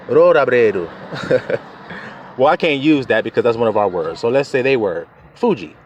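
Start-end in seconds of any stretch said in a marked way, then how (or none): 0.1-1.6 Rorabreru very slowly, tapped r; slow Japanese pronunciation. Shown as a demonstration. Preacher saying the word to honor Japanese, slow. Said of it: "Let me honor them. They say".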